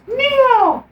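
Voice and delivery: very high, loud call of a name; mock shout. Trinity calling out to Neo in the fight, high shout. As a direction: shout his name across the battle. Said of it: "She shouts".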